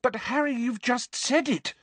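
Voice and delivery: fierce, high-pitched objection. A narrator's reading. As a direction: insist fiercely without raising volume. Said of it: "said Hermione fiercely".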